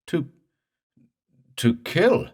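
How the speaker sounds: high, choked stammer. Acted. Morse chokes on the news, high and stammering. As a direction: choke out the words in horror. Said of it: "Morse choked".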